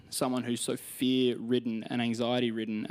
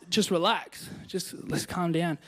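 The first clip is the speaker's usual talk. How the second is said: calming Jesus; 6 semitones higher, slower than usual. Retold or imitated.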